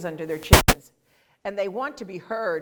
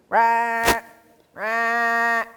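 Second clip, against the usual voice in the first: growled rah calls; growling toad; 3 semitones higher, 4 dB louder than usual. Shown as a demonstration. A teacher imitating a growling toad. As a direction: growl it like an angry sheep. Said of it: "growl in the sand".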